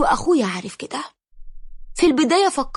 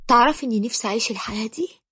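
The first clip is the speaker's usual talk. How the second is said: ordinary delivery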